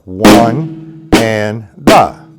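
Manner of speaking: drawn-out count over the drums; teacher counting a triplet, very slowly. Shown as a demonstration. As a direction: stretch each syllable as you play. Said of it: "remember we made right become a triplet".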